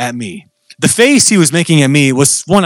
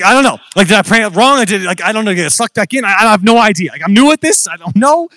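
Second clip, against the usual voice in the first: high-pitched, flustered babbling; mock-frantic protest of cluelessness; panicked confusion; 6 semitones higher than usual. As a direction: act out your own panic, voice pitched up. Acts out his own panicked confusion.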